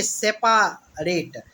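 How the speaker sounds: "सेपरेट" slowly split as "से-पा-रेट"; full "पा" in the middle of "सेपरेट", then "रेट"; said as written. Shown as a demonstration. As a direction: stretch it out, "सेपा... रेट". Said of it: "जैसा लिखा हुआ है वैसे ही".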